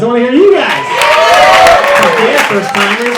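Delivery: loud, high call to the room; shouting to crowd. Real, not acted. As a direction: yell it out to the back row. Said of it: "calling to crowd".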